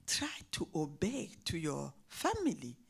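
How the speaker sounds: soft, breathy whisper of a mother's plea; whispered advice. Retold or imitated. Mother whispering advice to her daughter, hushed. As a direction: hush it like a mother pleading.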